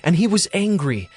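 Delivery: ordinary talk, nothing marked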